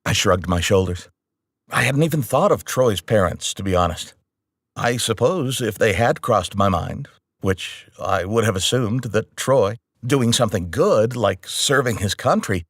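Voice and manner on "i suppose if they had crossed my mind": ordinary delivery, nothing marked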